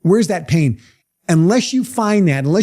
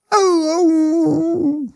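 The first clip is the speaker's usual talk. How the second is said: a long, much higher mournful howl; dog howl; 12 semitones higher than usual. Retold or imitated. Old hound dog lying on a nail, howling high. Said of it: "the dog would be howling".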